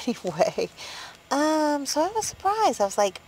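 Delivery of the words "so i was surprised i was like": normal talking voice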